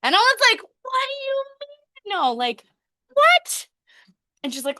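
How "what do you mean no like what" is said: high-pitched, incredulous mock squeal; retold astonishment. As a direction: act out stunned disbelief, shooting up in pitch. Retold shock at mother's answer.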